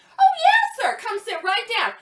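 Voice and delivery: high, loud fake-gushing greeting, easing down; exaggerated customer-service politeness; mock welcome. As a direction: overdo the welcome to annoy him. Acted fake welcome to hated customer.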